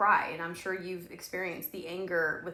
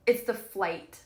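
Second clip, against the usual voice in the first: usual speaking voice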